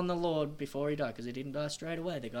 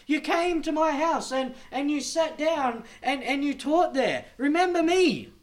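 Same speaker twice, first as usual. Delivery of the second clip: high, loud, insistent plea to Jesus; pleading religious people; 10 semitones higher, 9 dB louder than usual. Retold or imitated. Religious people pleading with Jesus to be remembered, loud high plea. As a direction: beg him to remember you, louder and louder.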